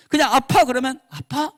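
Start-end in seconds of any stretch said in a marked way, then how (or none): 0.3-0.6 high, louder caring "아파?"; sympathetic echo. Shown as a demonstration. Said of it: "위로가 되지 않는다는 겁니다". 1.1-1.5 high, soft, hushed "아파?"; softer sympathetic echo. Shown as a demonstration. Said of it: "이거예요".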